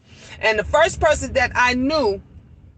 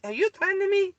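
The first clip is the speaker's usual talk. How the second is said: higher, softer, half-mocking disbelief; retold disbelief; 9 semitones higher, 4 dB quieter, faster than usual. To the angry tenant, higher and softer in disbelief. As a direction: ask it like you can't believe the threat. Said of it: "and I'm like".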